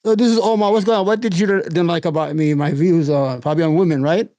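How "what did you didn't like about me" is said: ordinary talk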